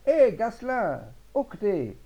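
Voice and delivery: high, loud hail across the street; calling out. Acted. Farmer hailing his son-in-law in the café, loud call. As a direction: shout his name to fetch him.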